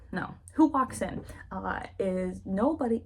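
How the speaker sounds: ordinary talk, nothing marked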